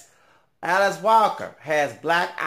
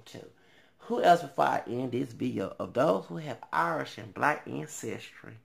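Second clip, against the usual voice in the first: ordinary talk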